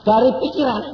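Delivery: loud, high pulpit delivery; fervent loud sermon; preaching at full force. Real, not acted. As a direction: drive it home to the congregation.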